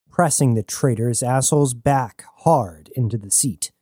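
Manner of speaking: plain reading voice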